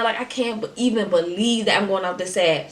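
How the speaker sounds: ordinary talk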